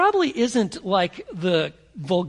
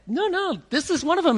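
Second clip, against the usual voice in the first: much higher, insistent; insisting group; 9 semitones higher than usual. Acted. Bystanders insisting Peter is one of them, high and insistent. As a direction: press the point, no arguing.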